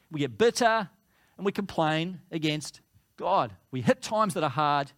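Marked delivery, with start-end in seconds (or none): none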